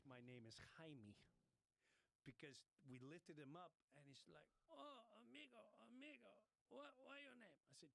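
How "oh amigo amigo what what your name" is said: put-on high-pitched Spanish-accented voice; Spanish-accented imitation. Rescued man thanking his helpers, high Spanish-accented imitation.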